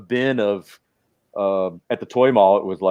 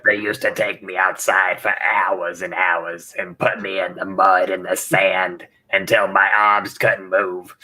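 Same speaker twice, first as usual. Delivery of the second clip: put-on old lady voice; 4 semitones lower than usual. Continues the Barbara voice.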